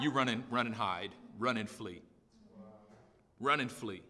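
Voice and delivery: very low, soft preaching; hushed letdown. Real, not acted. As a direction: drop right down, low and quiet. Preacher drops voice on fleeing.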